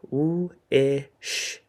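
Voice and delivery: slow segmented sounding out; sound-by-sound tapping. Shown as a demonstration. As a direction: stretch each sound apart as you tap. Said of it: "So, for example".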